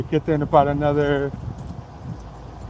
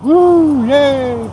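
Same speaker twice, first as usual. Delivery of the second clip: loud, high-pitched whoop and yay; celebratory cheer; 10 semitones higher, 7 dB louder than usual. Real, not acted. Streamer celebrating having his other phone, cheering. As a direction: let out a big, high cheer.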